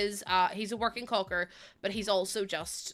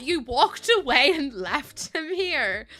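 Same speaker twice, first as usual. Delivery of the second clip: indignant retort; 5 semitones higher, 6 dB louder, slower than usual. Retold or imitated. Retold telling-off of dog owner.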